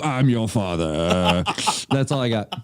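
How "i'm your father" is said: low Vader line, far from his own voice; Darth Vader. Pretend. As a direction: drop low and menacing like the masked villain. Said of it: "when I did the Darth Vader".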